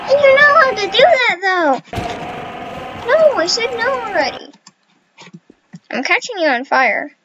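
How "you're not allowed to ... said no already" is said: indignant yelling. Real, not acted. Protesting at attacker in game.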